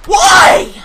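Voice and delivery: crying, screaming in frustration; wailing rage at the game; raging gamer. Real, not acted.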